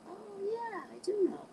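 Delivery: high, soft mock realization; coy self-assurance. Woman answering doubting admirers, coy and sure. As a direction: coo it softly, pitched well up. Acting out her coy, cocky reply.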